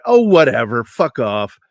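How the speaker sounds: loud dismissive retort, falling away; acted-out scoffing; dismissive brush-off. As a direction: wave him off loudly. Brushing off a warning.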